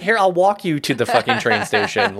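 high, loud burst of eagerness; over-the-top enthusiastic local offering help; eagerly helpful Chicagoan. Acted. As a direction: jump in, thrilled to help a lost stranger. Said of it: "they're like".

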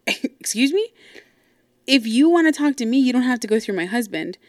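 high-pitched, affronted comeback; indignant retort. Retold or imitated. Sister answering dad's brush-off, acted indignation.

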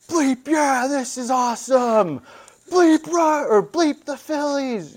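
put-on drunk fan. Drunk fan bellowing at his seatmates in the stands.

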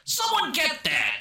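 high cartoon bark; mock gangster order. Retelling the movie, Ray Liotta's high mock order. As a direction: squeak out the order.

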